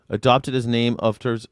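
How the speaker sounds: ordinary talk, nothing marked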